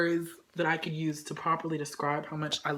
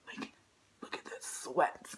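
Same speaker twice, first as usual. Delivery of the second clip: hushed whisper; 8 semitones lower, 9 dB quieter than usual. Real, not acted. Hushed teasing.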